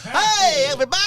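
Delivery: announcer voice. Acted. Showing Nebraska weather announcer voice.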